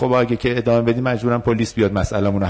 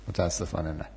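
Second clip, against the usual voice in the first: quiet firm refusal; 5 semitones lower, 12 dB quieter than usual. Shown as a demonstration.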